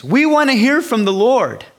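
high, loud eager demand; the crowd asking to hear God; eager people. Retold or imitated.

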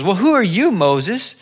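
acting out the grumbling Israelites; mock complaining. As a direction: challenge his authority indignantly. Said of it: "they started complaining against Moses".

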